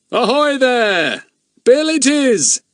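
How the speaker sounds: high, loud, drawn-out ahoy; hailing shout. Acted. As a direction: holler up to friends on a ship. Man hails the ship from below.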